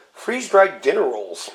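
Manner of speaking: ordinary talk, nothing marked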